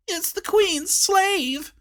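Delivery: a squeaky tattletale; squeaking voice. A narrator's reading. Squeaky dwarf recognising the queen's servant, squeaking. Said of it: "in his squeaking voice".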